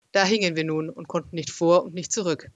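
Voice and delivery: usual speaking voice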